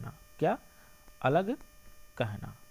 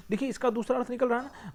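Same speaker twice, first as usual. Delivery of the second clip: ordinary talk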